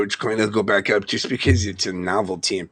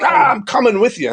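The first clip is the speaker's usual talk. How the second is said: higher and louder, a sudden eager yes; eager outburst; 11 semitones higher, 5 dB louder than usual. Retold or imitated. Retelling jumping into a buddy's truck, excited. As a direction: blurt it out like you just decided on the spot. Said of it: "And I'm like".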